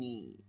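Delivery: "Ni" low and soft, sinking; third tone. Shown as a demonstration. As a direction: drop "Ni" to a low murmur. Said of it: "Es un tercer tono". ("Ni" en tercer tono, grave)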